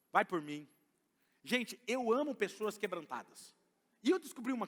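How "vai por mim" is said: mock crying. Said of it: "outra forma que eles vão usar é o choro".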